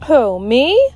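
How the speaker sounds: high, loud fake innocence; feigned surprise. Teacher to pupils, feigning innocent surprise. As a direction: play innocent, too surprised. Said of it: "like she was surprised".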